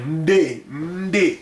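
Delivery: Ndé with a prenasalised n, teacher demo; pronounce Ndé. Shown as a demonstration. Teacher demonstrating Ndé to students, prenasalised n before the D. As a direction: say Ndé as one syllable, nasal onset into D. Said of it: "Écoutez bien ce, cette, cette prononciation-là".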